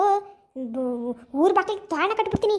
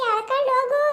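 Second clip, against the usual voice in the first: crying plea; 7 semitones higher than usual. Acted. Beaten woman begs to be pulled away.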